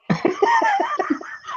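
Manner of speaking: squealing high laugh. Real, not acted. Laughing at the story.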